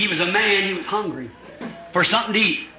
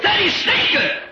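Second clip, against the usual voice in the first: drawn-out exclamation; 13 semitones higher, slower than usual. Acted. High slow exclamation.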